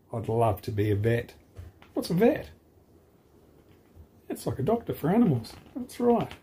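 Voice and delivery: lively read-aloud for a class; picture book reading. Acted. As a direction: read the rhyming story brightly to children. Teacher reads a picture book.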